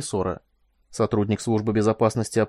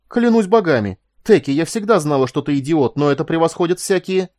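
loud, high exasperated scolding; indignant outburst; outraged exclamation; 5 semitones higher, 7 dB louder than usual. A narrator's reading. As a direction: lay into a fool at full voice. Acted outrage at an idiot colleague.

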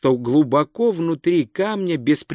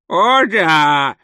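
громкое шепелявое стариковское ехидство; протяни саркастически, беззубо шепелявя; сарказм; 3 semitones higher, 6 dB louder than usual. A narrator's reading. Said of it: "саркастически откликнулся Коэн". (саркастически, шепелявым стариком)

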